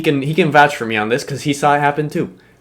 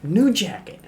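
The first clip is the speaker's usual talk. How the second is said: high, lightly mocking whine; higher-pitched mock upset; upset classmate impression; 5 semitones higher, 4 dB quieter than usual. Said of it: "And she's like".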